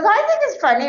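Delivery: high-pitched imitation of a husband insisting; hammed-up husband's objection; mock protest.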